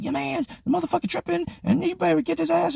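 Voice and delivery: high-pitched mock yelling; imitated women yelling. Women outside the door yelling about his friend, imitated high yell. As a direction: complain in a very high voice, as an angry woman. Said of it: "women outside the door yelling".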